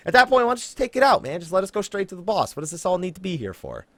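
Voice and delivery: ordinary delivery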